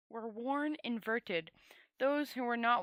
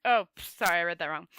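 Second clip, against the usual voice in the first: ordinary delivery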